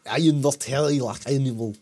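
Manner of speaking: heavy mock Russian-Austrian accent; hammed-up Schwarzenegger-style Russian accent; Russian Arnold Schwarzenegger accent. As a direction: echo it in a silly Russian strongman accent. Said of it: "a Russian Arnold Schwarzenegger".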